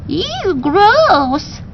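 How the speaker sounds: high squeaky ew of disgust; disgusted parrot. Acted. Parrot recoiling from the dribbling beast, disgusted. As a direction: recoil at something slimy.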